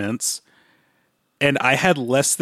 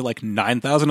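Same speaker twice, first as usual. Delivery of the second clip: ordinary talk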